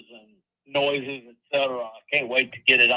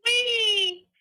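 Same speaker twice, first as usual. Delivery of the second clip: high effect squeal. Shown as a demonstration. Playing with voice effect.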